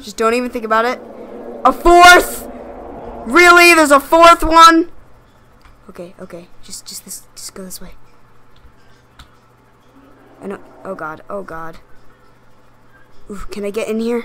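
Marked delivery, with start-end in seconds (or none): 5.9-11.8 whispering. Real, not acted. Sneaking past monster.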